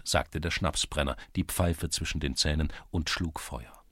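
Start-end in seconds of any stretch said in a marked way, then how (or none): none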